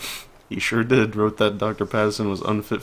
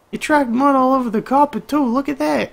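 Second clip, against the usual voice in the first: very high, loud falsetto complaint; high-voiced nagging wife; 7 dB louder than usual. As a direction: nag him in a piercingly high character voice. Acting Nora complaining about mud.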